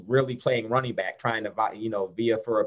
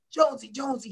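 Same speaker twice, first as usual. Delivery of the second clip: high, strained coach's yell; mock yell of a fired-up coach; imitating coach yelling; 12 semitones higher, faster than usual. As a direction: call his name like an angry coach. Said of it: "he was like".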